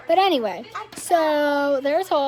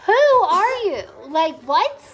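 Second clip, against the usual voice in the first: loud, high, indignant who are you; outraged; 4 semitones higher, 8 dB louder, slower than usual. Real, not acted. A mother ranting at strangers to viewers, outraged.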